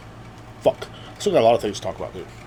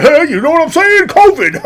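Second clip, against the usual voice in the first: much higher, louder hack-comic yell; mocking bad comedians; 11 dB louder than usual. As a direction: ham it up like an unfunny comedian. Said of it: "They're like".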